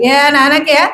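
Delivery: ordinary talk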